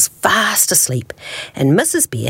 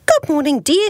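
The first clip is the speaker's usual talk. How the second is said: high morning greeting; cheery wife bear; 11 semitones higher than usual. A narrator's reading. Missus Bear waking beside her husband, cheery greeting.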